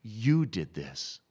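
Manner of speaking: slow, emphatic retold accusation; measured, weighty pronouncement; pointed accusation. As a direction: deliver it slowly, like a prophet confronting a king. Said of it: "he points to David and says".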